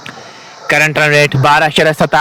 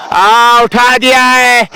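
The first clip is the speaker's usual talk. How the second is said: high, stretched-out excited call; excited six call; 6 semitones higher, slower than usual. Real, not acted. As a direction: ride the six over long on. Six hit, commentator erupts.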